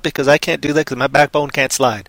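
ordinary talk

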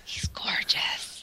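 slow, breathed whisper; swooning whisper. Real, not acted. Co-host admiring Brad Pitt, slow swooning whisper. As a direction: whisper it slowly, smitten.

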